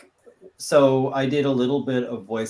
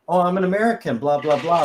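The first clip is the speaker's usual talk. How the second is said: higher, louder mock American babble; mock fake American; 6 semitones higher, 5 dB louder, faster than usual. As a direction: pretend to be someone faking an American. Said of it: "if they're faking, like".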